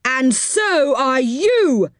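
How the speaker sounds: high, drawn-out indignant yell; yelled retort. A narrator's reading. Sticky man spots syrup on the culprit, indignant yell. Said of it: "yelled What's-His-Name".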